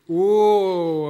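long, loud mock whoa; imitated shocked reaction. Preacher voicing everyone hearing the verse, mock shock. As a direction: draw out a loud imitated gasp. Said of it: "and everyone go".

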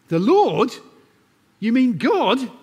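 high, loud disbelief; stunned man on a cliff; astonished. A narrator's reading. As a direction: gasp it out in amazement. Jack astonished.

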